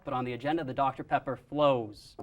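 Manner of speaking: normal talking voice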